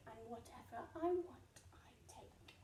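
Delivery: menacing whispered threat. Acted. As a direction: drop to a sly whisper. Rat's threat drops to whisper.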